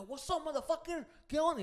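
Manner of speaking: high, loud imitation of an aggressor; hammed-up fighter callout; mock challenge.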